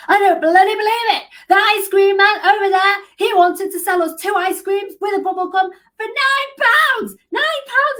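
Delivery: put-on outraged little girl, loud, high North English accent. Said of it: "really strong kind of North, North English kind of accent".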